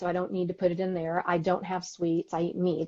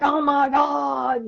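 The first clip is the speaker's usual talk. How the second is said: slow, loud, high exasperated cry at the news; angry outburst; 7 semitones higher, 7 dB louder, much slower than usual. Retold or imitated. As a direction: blow up at the TV. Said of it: "I would just get so angry".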